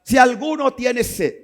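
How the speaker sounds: high, loud, slowed proclamation; preacher voicing Jesus crying out to the thirsty crowd; Jesus shouting. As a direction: call out to everyone who is thirsty. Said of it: "Jesús tiene que gritar".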